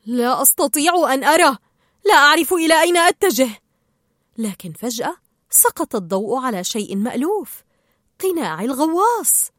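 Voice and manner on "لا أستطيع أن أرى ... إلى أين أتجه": loud, hurried cry of alarm; shouting. A narrator's reading. Lost fish calls out in the dark, shouting. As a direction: call out loudly, quickening. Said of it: "فصاح مارلين".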